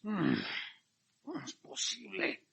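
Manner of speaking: mild mock disgust; disgusted sigh. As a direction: huff in mock disapproval. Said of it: "suspirar, pero con disgusto".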